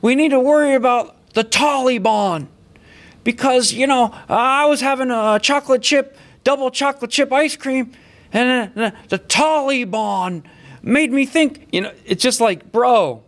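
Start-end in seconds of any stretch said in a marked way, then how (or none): 0.0-11.7 put-on high, rambling doddering old politician; mock him as a confused old man, pitched way up; mocking Joe Biden. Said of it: "his stupid Scranton voice".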